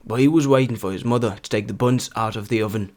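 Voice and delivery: Northern English dialect accent; північноанглійська вимова. Shown as a demonstration. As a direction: read it like Northern England, buns as "boonz". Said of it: "максимально наблизити до вимови північноанглійських діалектів". (північноанглійський діалектний акцент)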